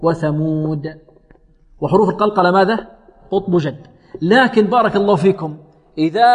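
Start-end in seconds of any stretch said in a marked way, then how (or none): 0.0-1.0 وثمود with long uu and an echoed د; clear qalqala. Shown as a demonstration. Teacher demonstrating a clearer release, clear qalqala. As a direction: bounce the final د of وثمود distinctly. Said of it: "قلقل أبين".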